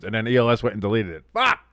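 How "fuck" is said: ordinary talk, nothing marked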